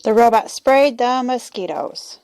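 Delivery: ordinary delivery, nothing marked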